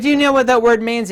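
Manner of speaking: high, loud incredulous question; challenging retort. Retold or imitated. Lecturer retelling his comeback to objectors, incredulous challenge.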